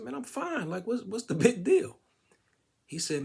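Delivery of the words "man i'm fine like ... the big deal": much higher, breezy and puzzled; mock carefree. To the paramedic, much higher breezy carefree answer. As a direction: toss it off like nothing happened.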